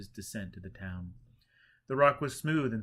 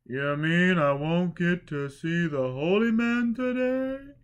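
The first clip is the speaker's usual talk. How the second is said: high, louder, slow dismayed question; dismayed drunkard; 8 semitones higher, 10 dB louder, slower than usual. A narrator's reading. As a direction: drag it out in disbelief, high and loud. Drunk young man asks.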